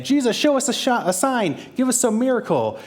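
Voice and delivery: high, loud demanding plea from the crowd; clamoring crowd. Retold or imitated. Crowd pressing in on Jesus, demanding a sign, loud and high. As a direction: beg loudly like a pushy crowd.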